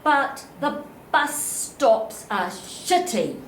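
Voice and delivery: normal talking voice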